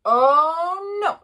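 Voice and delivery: long, loud, rising groaned "oh", then a drop; hammed-up fatherly dismay; exasperated dismay. A narrator's reading. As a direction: draw out the horror like an appalled dad.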